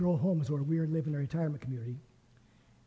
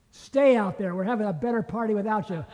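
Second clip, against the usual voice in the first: louder, higher, a teasing brush-off; mock dismissive retort; 4 semitones higher, 6 dB louder than usual. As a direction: tell him off with a grin, quicker at the end. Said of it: "what I would have done as the father".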